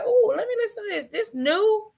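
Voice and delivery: high, quick quoted reaction of pleasant surprise; intrigued. Retold or imitated. Co-host retelling how she discovered a new song, intrigued. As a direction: pitch jumps up, curious and eager.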